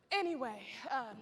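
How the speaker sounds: ordinary talk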